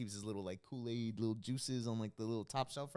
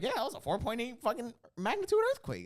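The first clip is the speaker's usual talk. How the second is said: high, loud retold announcement; hammed-up excited housemate; imitated excited report; 12 semitones higher, 6 dB louder than usual. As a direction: pitch it way up, breaking big news. Acts out Sadie breaking the news.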